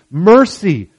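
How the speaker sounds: loud, high, pleading cry; desperate cry for mercy. Acted. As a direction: beg for mercy at the top of your voice. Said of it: "crying out to Jesus for mercy".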